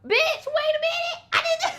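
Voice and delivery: very high, squealed indignant retort; mock outrage. Reacting to a friend's insult in the story, high-pitched mock outrage. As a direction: cut in with your pitch shot way up.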